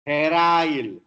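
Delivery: usual speaking voice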